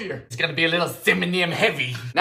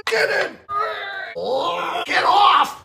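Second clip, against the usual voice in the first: mock wail; 10 semitones higher, slower than usual. Fighting sticky batter.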